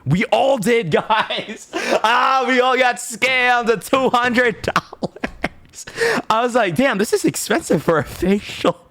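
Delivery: high, laughing through the words; real laughter, pitched high. Real, not acted. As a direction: giggle out the confession of being scammed. Laughing about the scam.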